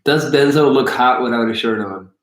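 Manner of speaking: ordinary delivery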